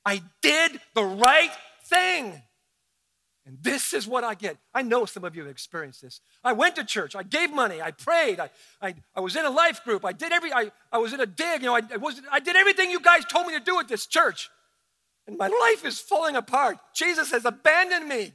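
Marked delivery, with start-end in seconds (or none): 0.0-2.4 louder, slow, bitter protest; a churchgoer resentful at God; bitter complaint. Retold or imitated. Voicing angry churchgoer. 6.4-18.3 loud, higher, aggrieved list; a churchgoer complaining God left them; frustrated believer. Retold or imitated.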